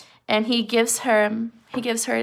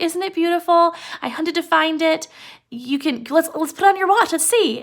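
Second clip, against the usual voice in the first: eager wife; 6 semitones higher, 4 dB louder than usual. Retold or imitated. Wife voiced giving gift.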